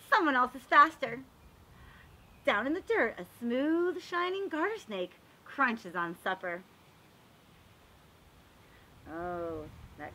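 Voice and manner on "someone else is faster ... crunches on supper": storybook narration. Acted. Teacher reading a garden book aloud to the class, storybook voice. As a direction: read it like a picture book for little kids. Reads picture book to children.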